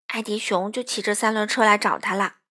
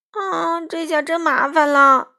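louder, fretting cry of dismay; frightened bear realizing his trouble; worried; 7 semitones higher, 8 dB louder, slower than usual. A narrator's reading. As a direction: sound truly troubled. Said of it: "艾迪熊很发愁".

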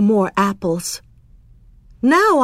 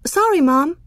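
put-on high child's apology; apologetic child; 7 semitones higher than usual. A narrator's reading. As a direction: pitch it up, a sheepish child to mom. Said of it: "I said".

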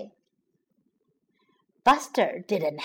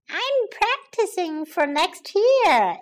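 little sister explaining; 7 semitones higher, slower than usual. Acted. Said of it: "explained D W".

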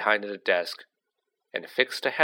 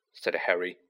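plain reading voice

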